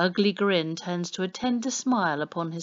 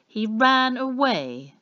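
loud, slow, higher incredulous echo; mock shock; 7 semitones higher, 6 dB louder, slower than usual. A narrator's reading. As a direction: feign outrage at the news. Policeman feigns shock.

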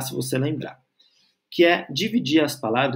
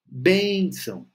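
"Bênção" drawn out on the BÊN; slow teaching "Bênção", first syllable prominent; stressed first syllable; 3 semitones higher, much slower than usual. Shown as a demonstration. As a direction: lean long on BÊN in "Bênção". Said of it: "a sílaba tônica".

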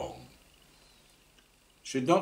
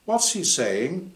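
curious question; 8 semitones higher, slower than usual. Acted. Susie asks companion.

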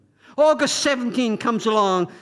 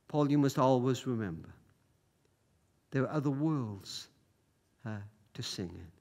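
hushed final message; 9 semitones lower, 8 dB quieter than usual. Retold or imitated. Relayed final message from Sally.

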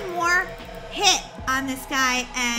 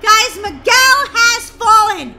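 excited shouting; 7 semitones higher, 10 dB louder than usual. Real, not acted. Friend fell in battle.

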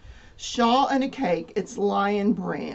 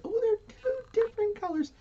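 surprised; 13 semitones higher, 3 dB quieter than usual. Real, not acted. Surprised at two colours.

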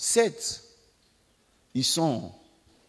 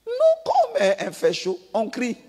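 much higher and louder, a sudden pained cry; a hammed-up yelp; mock yelp of pain; 8 semitones higher, 5 dB louder than usual. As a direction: act out being burned by an iron. Reaction to hot iron.

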